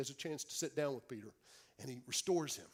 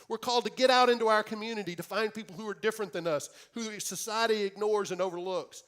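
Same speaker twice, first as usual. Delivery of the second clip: louder, higher, preaching with building fervour; preaching at full force; 6 semitones higher, 7 dB louder than usual. Acted. Preacher urging the congregation, full-force preaching. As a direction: proclaim it to the whole church.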